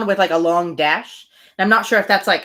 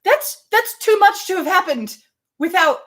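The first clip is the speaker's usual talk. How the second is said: mock disbelief; 10 semitones higher than usual. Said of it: "her just being like".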